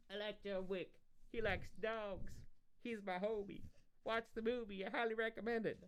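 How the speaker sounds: high Southern character voice for the dog; Southern accent. Acted. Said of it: "I don't know why she's Southern".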